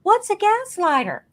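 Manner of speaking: high, loud, baffled question; imitated puzzled mom. Mom asking daughter about unfamiliar word, imitated bafflement. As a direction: ask it high-pitched like a clueless mother. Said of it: "And she goes".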